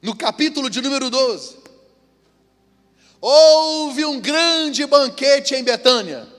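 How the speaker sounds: high, slow, loud preaching; full-force pulpit voice. Acted. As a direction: proclaim the scripture slowly.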